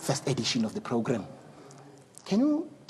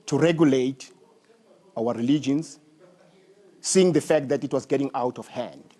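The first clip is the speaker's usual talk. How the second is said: host's opening; 5 dB louder than usual. Acted. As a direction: announce the topic loud and strong, like a broadcast host. Host opens the program.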